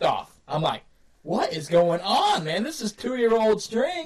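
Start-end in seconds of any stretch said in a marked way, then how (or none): 1.2-4.1 high, drawn-out indignant outburst; acted-out exasperation at broken gear; exasperated disbelief. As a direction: protest it slowly, pitch climbing on the end. Acting out finding snapped bowstring.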